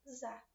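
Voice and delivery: za said softly and short, as the weak end of casa; light, weak za; weak unstressed syllable. Shown as a demonstration. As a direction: let za drop, unstressed.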